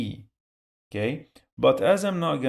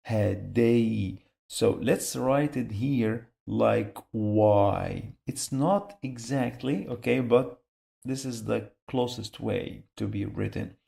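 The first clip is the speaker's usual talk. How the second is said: ordinary talk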